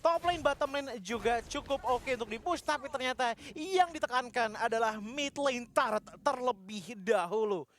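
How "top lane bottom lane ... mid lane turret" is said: energetic caster recap; upbeat esports commentary; fighting game announcer. Acted. Hyped recap after teamfight. (komentator yang bersemangat)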